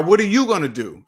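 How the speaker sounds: ordinary talk, nothing marked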